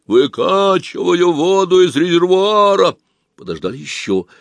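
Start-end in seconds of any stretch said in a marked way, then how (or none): 0.0-2.9 slow hollow voice through a tube; sepulchral answer. Acted. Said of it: "И замогильный ответ".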